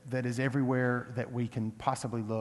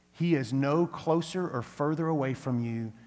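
ordinary talk

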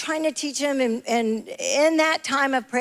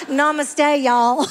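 laughing Southern drawn greeting; Southern-accented Hindi; 3 semitones higher, 4 dB louder, much slower than usual. Retold or imitated. Imitating the girls' Hindi for church, laughing Southern accent. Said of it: "speaking Hindi with a Southern accent".

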